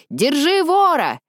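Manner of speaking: high, slow shout across the street; shouting thief. Pretend. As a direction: call out to stop a thief. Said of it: "крикнет на всю улицу".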